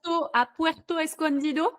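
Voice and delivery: trying to get "Puerto Escondido" right in Spanish; careful Spanish name. Real, not acted. Host unsure of the Spanish place name, says it carefully. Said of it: "si je dis bien".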